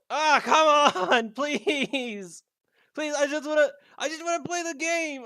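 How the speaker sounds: loud laughing plea; laughing pleading. Real, not acted. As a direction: beg through laughter. Begging the game to load.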